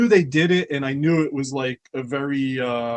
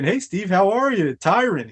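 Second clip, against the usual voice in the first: higher-pitched mock pop-in hello; chirpy retold greeting; 7 semitones higher, 4 dB louder than usual. Pestering Steve about the project, chirpy retold greeting. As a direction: imitate yourself popping in with a hopeful pitch. Acting out his repeated cheery pitch.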